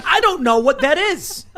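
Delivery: loud, high, drawn-out mock yell; hammed-up baffled shouting; exasperated yell. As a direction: cry it out in comic bafflement. Acting out bewildered regular people.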